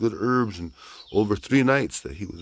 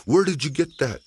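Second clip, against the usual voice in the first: alarmed aunt; 9 semitones higher, 4 dB louder than usual. Acted. Aunt sees the bag.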